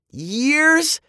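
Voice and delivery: high, loud, astonished question; exclaimed. A narrator's reading. Kids hearing it is years away, astonished exclamation. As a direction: exclaim it in disbelief. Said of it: "both kids exclaimed".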